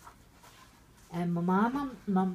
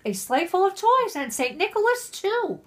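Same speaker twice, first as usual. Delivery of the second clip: excited reading; 10 semitones higher, 6 dB louder than usual. Acted. Hammed-up storybook climax.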